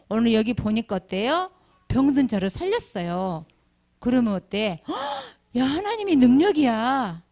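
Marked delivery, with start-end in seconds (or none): 4.9-7.2 hammed-up onlooker marvelling at healing; mock astonished. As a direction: gasp then higher amazed exclaim. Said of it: "이렇게 생각을 하는데".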